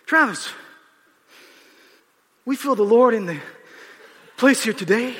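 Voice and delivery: imitating teacher mocking a revival call. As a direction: tease him with a mock sermon. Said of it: "He goes".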